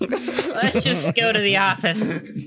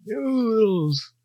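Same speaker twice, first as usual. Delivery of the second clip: put-on very old man; 5 semitones higher than usual. GM voices old clerk.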